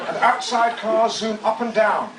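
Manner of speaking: grandly narrated, savouring each phrase; dramatic book reading. Acted. Author reading his memoir to an audience, pompously. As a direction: read it like a pompous audiobook.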